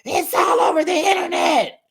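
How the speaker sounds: high, loud yelled line; hollering. Retold or imitated. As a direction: holler it across the room. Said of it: "Tamara is hollering".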